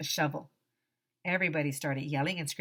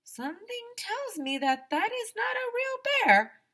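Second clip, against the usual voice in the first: high, steady voiced Mister Cooper; teacher's voice. Mister Cooper doubts the bear, high put-on voice. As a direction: play the teacher in a raised voice.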